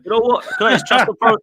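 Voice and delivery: loud, high and sarcastic, right at Curtis; mock exasperation. Real, not acted. To co-host Curtis, mocking trust the process, loud sarcastic outburst. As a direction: throw the slogan back at him, fed up.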